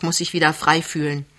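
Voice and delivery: ordinary delivery, nothing marked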